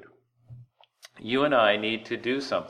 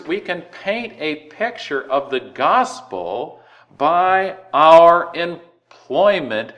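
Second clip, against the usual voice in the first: slow and louder, stretching our employment; loud preaching; 5 semitones higher, 7 dB louder, slower than usual. Acted. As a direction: push it out to the congregation. Preacher emphasis.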